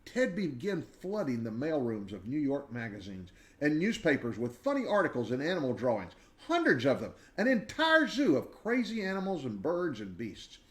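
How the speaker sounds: usual speaking voice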